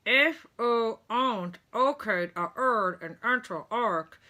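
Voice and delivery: loud, muffled, consonants gone; gauze-stuffed patient insisting; mouth full of gauze. A narrator's reading. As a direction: push the words through stuffed cheeks.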